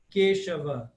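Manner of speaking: Keshava with a closed E; closed 'e' vowel in Keshava. Shown as a demonstration. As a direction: say 'KAY-shava' for Keshava. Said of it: "sempre fechado".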